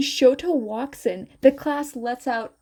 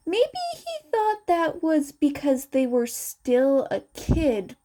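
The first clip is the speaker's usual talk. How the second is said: usual speaking voice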